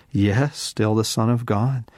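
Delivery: ordinary talk, nothing marked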